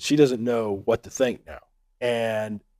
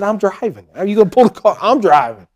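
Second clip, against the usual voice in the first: raised, high-pitched incredulous retort; mock protest; 6 semitones higher, 5 dB louder than usual. Husband protests to wife who threatens to pull over, loud mock protest. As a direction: play up an indignant driver's protest.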